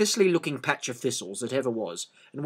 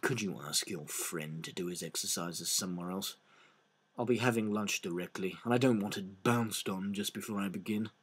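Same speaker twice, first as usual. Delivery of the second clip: much softer confiding donkey; loud whisper; 6 semitones lower, 13 dB quieter than usual. Acted. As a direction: lean in and mutter it. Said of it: "said in a loud whisper".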